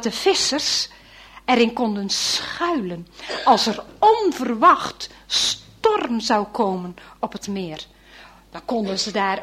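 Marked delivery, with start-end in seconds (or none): none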